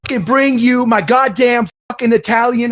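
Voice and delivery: loud, high tough-guy Italian rant; Italian meathead. Pretend. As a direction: yell it like a hotheaded mobster.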